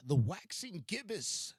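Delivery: high, loud, slow announcer voice; announcer read. Acted. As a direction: broadcast the moon phase like a radio host. Reads the moon report.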